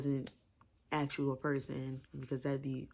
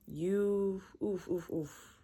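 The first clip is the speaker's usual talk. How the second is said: overwhelmed oofs; 4 semitones higher, much slower than usual. Real, not acted. Overwhelmed by the book.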